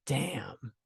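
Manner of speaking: near-whispered retold shock; very soft, low, floored reaction; hushed amazement. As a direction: drop to a stunned, whispery hush. Stunned by her son's insight.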